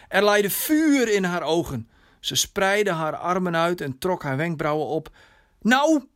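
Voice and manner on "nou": hoge, luide eis om antwoord; ongeduldige dochter. Acted. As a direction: gooi het er geërgerd uit, hoog en luid. Dochter eist ongeduldig een reactie. (ongeduldig, verontwaardigd)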